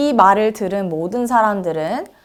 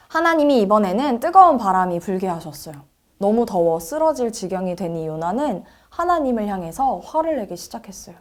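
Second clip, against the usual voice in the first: plain reading voice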